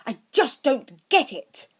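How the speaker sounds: high, loud, slow frustrated outburst; bursting out. A narrator's reading. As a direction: blurt it out in frustration. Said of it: "he burst out".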